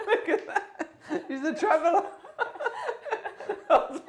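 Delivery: high, laughing tease; giggling at a friend's travel tale. Real, not acted. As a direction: crack up while mocking the traveller. Teasing friend, laughing at story.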